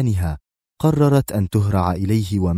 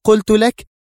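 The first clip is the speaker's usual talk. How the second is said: much higher, much louder outburst; exclaiming; 13 semitones higher, 7 dB louder than usual. A narrator's reading. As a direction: cry out in frustration. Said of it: "هتفت".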